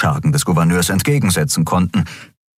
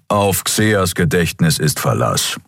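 usual speaking voice

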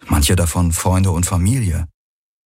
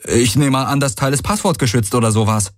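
calling back; 6 semitones higher than usual. A narrator's reading. Man guesses the teleporter is protected, calling back. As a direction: answer over the gallop, pitched up.

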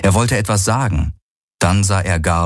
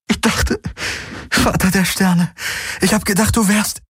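laughing and sobbing; 13 semitones higher than usual. Acted. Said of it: "lachen und gleichzeitig schluchzen".

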